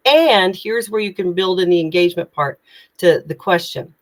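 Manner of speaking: ordinary talk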